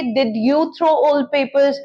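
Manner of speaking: very slow, each word apart; slow model question. Shown as a demonstration. Teacher models a where question, very slow. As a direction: ask it slowly for learners. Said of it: "simple past".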